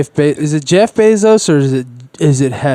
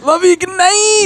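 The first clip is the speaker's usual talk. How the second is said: falsetto. Acted. Falsetto goodbye.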